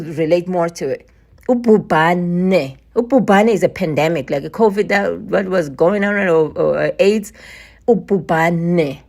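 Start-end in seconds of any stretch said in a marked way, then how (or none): 1.5-2.8 Ububane said slowly, every syllable u-bu-ba-ne clear; Bantu word demo. Shown as a demonstration. Teaching viewers a Bantu word, slow Ububane. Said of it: "that's what it's called in, in a Bantu language". 7.9-9.0 Ububane said slowly, every syllable u-bu-ba-ne clear; Bantu word demo. Shown as a demonstration. Teaching viewers a Bantu word, slow Ububane. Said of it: "that's what it's called in, in a Bantu language".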